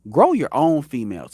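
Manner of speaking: ordinary talk, nothing marked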